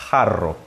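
"Jarro" with a trilled rr, pitch dropping; Spanish pronunciation. Shown as a demonstration. Teacher repeating the word for students, Spanish pronunciation. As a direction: say "Jarro" with an h-like j and rolled rr. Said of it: "Em espanhol".

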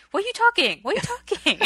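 high, loud imitation Korean accent; fake Korean accent. Dad questioning his kids, high mock Korean accent. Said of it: "he's got his fake Korean accent".

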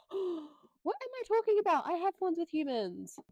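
high gasping surprise; sudden realization. Real, not acted. Realising her book pick has humans, high startled gasp. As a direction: catch yourself, startled and high. Realising mistake mid-sentence.

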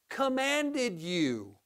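commanded drawn out very slowly; stress on commanded. Shown as a demonstration. Preacher contrasts options with orders, slow emphatic commanded. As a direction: lean hard on "commanded", stretching it. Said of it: "Not optioned it to you".

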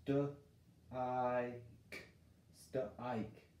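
"St-I-k" stretched slowly apart; sounding out. Shown as a demonstration. Teacher testing long I on stick, slow sounding out. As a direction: sound it out carefully. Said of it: "I'm gonna try the long I".

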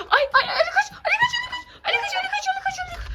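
high, crying playful panic; panicked high cry. Pretend. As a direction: squeal at the child to run. Pretend thief, telling child flee.

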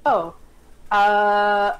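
ordinary talk

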